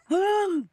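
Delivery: high, sudden gasp; sharp theatrical intake of breath; mock astonished gasp. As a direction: gasp as if it just dawned on you. Acts her sudden realisation.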